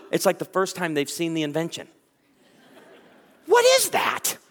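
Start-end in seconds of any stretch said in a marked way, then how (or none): 3.5-4.4 much higher, loud alarmed squeak; startled woman. Retold or imitated. Women in a room reacting to a camera, high alarmed squeak. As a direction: squeal like someone spotting a camera.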